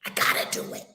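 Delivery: breathy self-talk whisper; whispered to herself. Real, not acted. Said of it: "repeat, second whispered".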